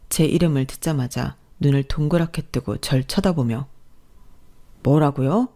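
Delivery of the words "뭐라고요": mildly startled question; taken aback, a touch of alarm; startled exclamation. A narrator's reading. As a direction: blurt it out in surprise. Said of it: "큰 소리로 놀라기 전까지는요".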